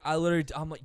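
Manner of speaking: ordinary talk, nothing marked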